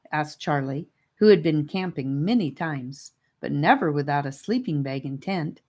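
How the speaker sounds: ordinary delivery, nothing marked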